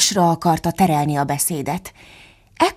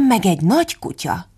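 ordinary delivery

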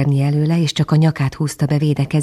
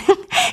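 bird laugh. A narrator's reading. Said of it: "nevetett a cinege".

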